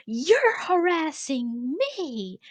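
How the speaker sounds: high, drawn-out mock screech; hammed-up outraged whine, slow and high; nasty retort imitation. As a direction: mimic her nastily, stretching each word up high. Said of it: "she starts to get nasty".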